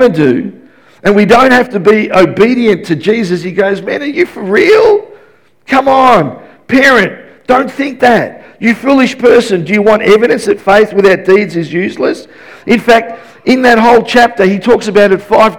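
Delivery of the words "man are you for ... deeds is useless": high-pitched incredulous challenge; James. Retold or imitated. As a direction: take them to task, pitched well up. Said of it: "Now he's challenging us".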